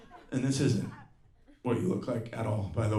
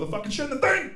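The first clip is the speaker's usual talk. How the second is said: high, exasperated dad outburst; hammed-up garbled angry yell; mock dad announcement; 13 semitones higher than usual. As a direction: imitate a ranting dad barging in. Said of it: "dads like to make random announcements".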